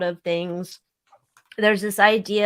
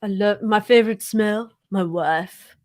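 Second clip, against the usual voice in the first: corny romantic voice. Retold or imitated. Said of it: "That's just so wrong".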